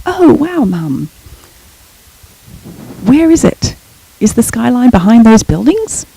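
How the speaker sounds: put-on an eight-year-old echoing his mother's excitement; excited child. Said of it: "went to match it".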